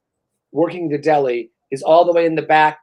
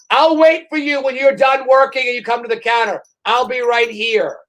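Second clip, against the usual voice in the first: shouted sarcastic politeness, higher, slowing at the end; mock shout; 5 semitones higher than usual. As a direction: yell the offer across the counter with fake patience. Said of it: "I wanna walk in and say".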